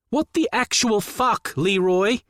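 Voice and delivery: high, incredulous outburst; angry brother. Acted. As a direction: demand it of him in disbelief. Furious brother calls on phone.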